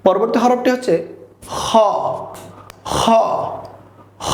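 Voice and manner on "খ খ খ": খ with air scraping out at the back of the mouth; back-of-mouth friction. Shown as a demonstration. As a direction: rub the breath through on খ. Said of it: "মুখ গহ্বরের সাথে একটু লাগিয়ে বের হবে". (বাতাস লাগিয়ে খ উচ্চারণ)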